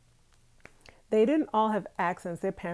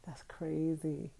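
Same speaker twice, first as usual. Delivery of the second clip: ordinary delivery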